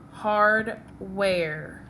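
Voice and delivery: ordinary talk, nothing marked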